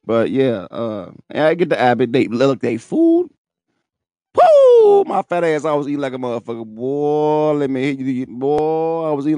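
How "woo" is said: high, loud joyful whoop. Real, not acted. Hyped up about the food he ate, loud whoop. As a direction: let out a big celebratory woo.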